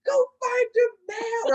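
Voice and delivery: high, loud half-laughing yell; mock-scold her to go back; shouting friends. Friends on the phone, shouting at her to find him.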